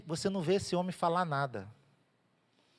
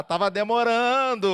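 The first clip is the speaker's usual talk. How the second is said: eager God; 4 semitones higher, 5 dB louder than usual. Acted. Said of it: "Aí Deus".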